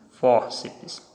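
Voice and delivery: normal talking voice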